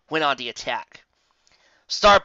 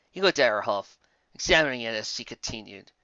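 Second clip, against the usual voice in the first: usual speaking voice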